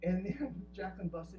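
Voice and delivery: usual speaking voice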